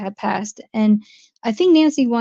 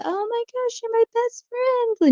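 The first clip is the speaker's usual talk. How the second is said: very high, soft squeal of phony friendship; fake gushing; 13 semitones higher, 3 dB quieter than usual. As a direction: gush sweetly in a mocking high falsetto. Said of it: "the fakeness of the".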